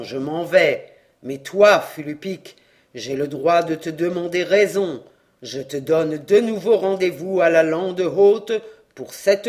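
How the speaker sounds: loud, stern voice from beyond the grave; menacing ghost voice. Acted. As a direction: threaten him like a vengeful spirit. Ghost of hanged man speaks.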